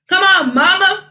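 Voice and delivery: high, loud laughing plea; playful urging. Real, not acted. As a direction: coax mama with a laugh. Urging her mother to join.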